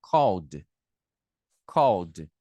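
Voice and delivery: Called said with a clear final d sound, kold; model pronunciation. Shown as a demonstration. Teacher models Called for the class, ending clearly in d. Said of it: "olha como que fica a pronúncia".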